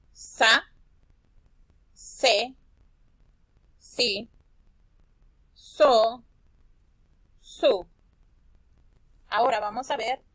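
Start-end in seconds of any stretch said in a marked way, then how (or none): 0.1-7.9 "Sa, se, si, so, su" called out loud one by one; syllable drill. Shown as a demonstration. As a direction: chant the syllables clearly for the kids. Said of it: "Vamos a repasar las sílabas de la s". (repaso de sílabas en voz alta)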